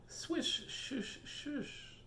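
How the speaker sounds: high whispered swishing; whispered swish sound. Acted. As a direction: hiss it softly like water. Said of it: "says the fish".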